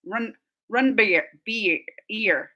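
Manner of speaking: slow, drawn-out long ee in "beer" and "ear"; long E demo. Shown as a demonstration. Streamer demonstrates vowel length to chat, slow long ee. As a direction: stretch the E in "beer" slowly. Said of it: "make the E longer".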